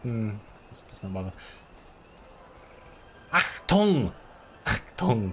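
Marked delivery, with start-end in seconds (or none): 3.3-4.1 loud higher barked command; German shout. Pretend. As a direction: bark it like a drill officer.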